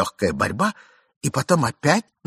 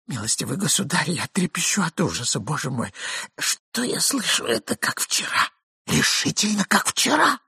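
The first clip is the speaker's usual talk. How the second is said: hushed panic; 7 semitones higher than usual. A narrator's reading. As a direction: dread in a hushed voice. Hiding man panics.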